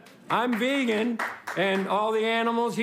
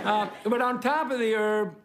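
usual speaking voice

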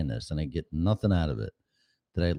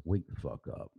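much softer, muttered under the breath; 11 dB quieter than usual. Real, not acted. Host muttering at himself to wake up, much softer. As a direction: mutter it almost to yourself. Repeated urging at dawn.

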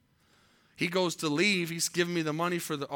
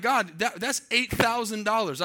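mildly angry retold protest, higher pitched; mad at God; 5 semitones higher, 4 dB louder than usual. Preacher retelling his complaint to God over lost money, mad. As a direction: protest to God, a touch of indignation. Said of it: "I was mad".

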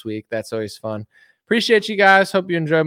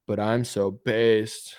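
ordinary delivery

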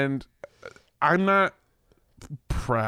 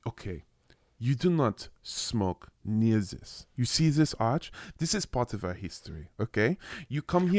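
put-on French man's accent; 4 semitones lower, 5 dB quieter than usual. Frenchman lecturing a smoker by the arch, French accent. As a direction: imitate a Frenchman lecturing a tourist, spaced out. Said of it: "starts yelling at me in French".

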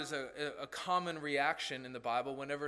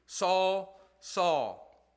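louder, resonant calling of the name; voice from heaven; 3 semitones higher, 6 dB louder than usual. Acted. The Lord calls Saul on the road, a resonant loud call.

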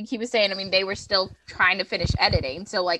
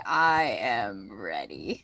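much lower and slowed right down; deep slow voice; 13 semitones lower, 5 dB quieter, much slower than usual. Pretend. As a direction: drop into a deep, dragged-out growl. Eager repeat, then deep version.

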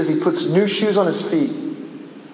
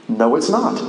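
usual speaking voice

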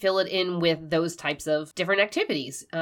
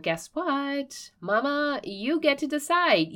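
excited; 9 semitones higher, slower than usual. Real, not acted. Excited encouraging address to mom.

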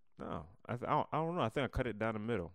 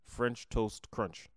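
slow, deliberate correction; careful enunciation; much slower than usual. Real, not acted. Correcting a slip on the cereal's name, careful enunciation. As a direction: place each word with care. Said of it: "I have to concentrate when I say it".

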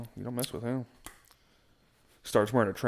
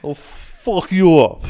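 put-on slow, loud threat in a Russian accent; hammed-up Russian tough guy accent; mock Russian accent; 7 semitones higher, 14 dB louder, much slower than usual. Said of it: "talking to them in a fucking Russian accent".

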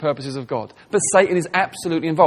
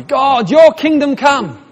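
higher, louder and very slow, a hammed-up pious plea; mock grand prayer; 9 semitones higher, 8 dB louder, much slower than usual. Congregation praying for God's kingdom, hammed-up and lofty. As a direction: parody an earnest pray-er. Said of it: "We all pray, don't we?".

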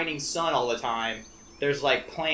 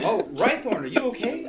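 higher-pitched, solicitous question; concerned; 6 semitones higher than usual. Acted. Player checks on the elf who tripped, high and concerned. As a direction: lift the voice in concern for him.

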